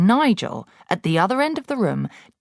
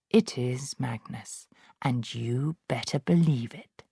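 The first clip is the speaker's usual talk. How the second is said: low, soft, slow spectral message; ghostly voice; 3 semitones lower, 5 dB quieter than usual. Acted. Ghost of Magnus revealing himself, low and slow. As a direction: intone it slowly from beyond.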